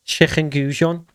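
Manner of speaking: Scouse "Chicken goujon" with a throaty ch in the K, drawn out slowly; slow imitation of a Scouse accent. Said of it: "when Scousers say chicken goujon".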